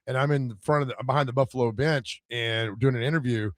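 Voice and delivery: ordinary talk, nothing marked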